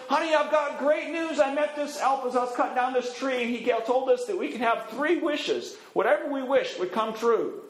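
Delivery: bursting to tell his wife; excited husband. Acted. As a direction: woodcutter excitedly telling his wife about the wishes. Husband tells wife great news.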